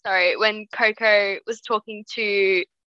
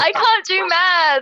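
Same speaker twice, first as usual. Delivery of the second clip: louder, higher, slowed protest; mock exasperated; 6 semitones higher, 5 dB louder, slower than usual. Real, not acted. To friends counting couples, an exasperated outburst. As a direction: throw up your hands at the numbers.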